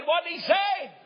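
put-on high, loud squawk of a deaf old woman; shrill old wife. Deaf wife asks husband.

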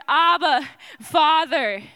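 loud, full cry. A narrator's reading. The stone crying out to the Father, loud cry. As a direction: call out to the father with everything. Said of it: "The very stone you rolled cries".

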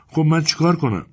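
a hint of a bored child; lightly voiced whining child. A narrator's reading. Bored girl answers busy mother.

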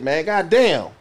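normal talking voice

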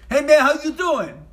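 high, loud Bronx greeting; Bronx accent. Retold or imitated. Young Bronx branch head greeting a candidate, in a brash Bronx accent. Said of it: "from the Bronx or something".

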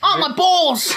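mock Aussie yelp. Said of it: "Australians talk like this".

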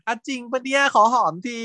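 a high, giddy laughing ask for a kiss; giddy mock flirt. Friend cheekily asks his buddy for a kiss, giddy and high. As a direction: toss it off lightly like a cheeky friend.

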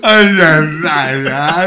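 loud, hammed-up wail; mock wailing. Mate suggesting how to answer the counsellor, mock wailing. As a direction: wail it out as if distraught. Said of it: "answered the phone and gone".

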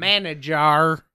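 slow Southern "Manager"; mock Southern "Manager"; Southern accent. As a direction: drawl "Manager" as man-uh-JAR. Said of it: "go back to the southern accent".

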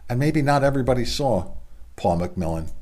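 ordinary delivery

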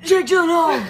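loud triumphant answer; shouting. Pretend. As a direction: blurt the name out loudly. Mock quiz answer shouted.

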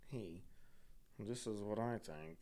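very soft, low and slow aside. Acted. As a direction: murmur it secretly into an ear. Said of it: "[name] gets to whisper in [name]'s right ear".

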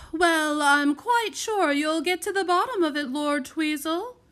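high, loud, gushing simper. A narrator's reading. Lady guest flattering the lord at dinner, simpering. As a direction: flatter him in a high fawning voice. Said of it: "simpered the tallest Heyo sister".

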